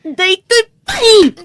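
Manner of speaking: loud, slow, crying fury; sobbing rage; furious sobbing. Acted. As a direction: strike with every word through tears. Wife attacking husband in fury.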